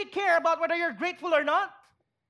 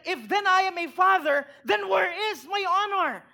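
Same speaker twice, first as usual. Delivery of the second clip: higher, louder angry shout; 5 semitones higher, 4 dB louder than usual. Shown as a demonstration. Pastor voicing God rebuking his people, angry shout. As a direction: thunder it like an offended father demanding respect. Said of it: "There's anger in this tone".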